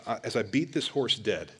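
ordinary talk

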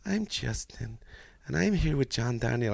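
softly mocking a drowsy presenter; sleepy host impression. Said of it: "Get a cup of coffee in you".

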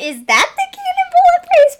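high, loud mock wondering; a high, loud quoted thought; mock confused thought. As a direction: squeak it out in disbelief. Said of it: "I was like".

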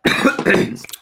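a dry, hacking cough; coughing fit. Real, not acted. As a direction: cough hard, sick for days. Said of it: "sorry if I'm coughing".

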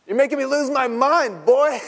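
high, loud scolding yell; exasperated mother yelling. Acted. Mary yelling at her son, high and loud. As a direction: yell like a fed-up mom. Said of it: "Mary scolding son".

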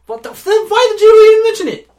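high, loud, rushed yell; exasperated outburst. Real, not acted. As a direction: explode at your co-host in comic disbelief. Exasperated outburst at co-host's slip.

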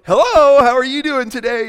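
high, loud, beaming phone greeting; audible smile. Shown as a demonstration. Phone support worker greeting a caller, loud and smiling. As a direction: answer with a big smile in the voice. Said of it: "They can hear you smile".

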